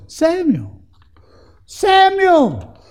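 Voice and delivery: a high, ringing call of the name from afar; God calling the sleeping boy Samuel by name, high and loud. Retold or imitated. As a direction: call the boy's name like a voice in the night. God calls Samuel at night.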